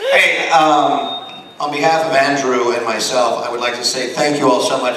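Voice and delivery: loud upbeat stage announcer thanks; host's loud closing; emcee sign-off. Real, not acted. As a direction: wrap up the show for the crowd.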